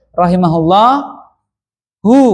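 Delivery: rahimahullahu with the final "hu" sounded separately; full ending. Shown as a demonstration. Teacher showing the complete original word, full ending. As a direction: hold rahimahullah, then add a clear hu. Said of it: "aslinya kalau komplit".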